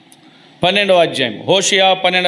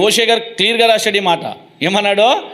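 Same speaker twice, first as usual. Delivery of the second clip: normal talking voice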